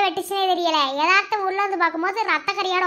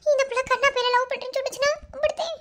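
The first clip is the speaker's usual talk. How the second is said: high, distressed fretting cries; panicked wailing; 9 semitones higher than usual. Acted. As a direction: wring your hands and wail in panic. Woman panics at the news. (பதற்றமான புலம்பல்)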